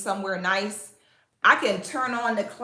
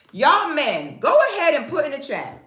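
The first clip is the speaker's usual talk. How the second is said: calling out to viewers; 6 semitones higher, 6 dB louder than usual. Real, not acted.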